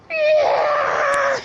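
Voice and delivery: very high strained scream; acted scream. Injured fighter as his arm is grabbed, high scream. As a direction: scream in mock agony. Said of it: "screaming as hard as I can".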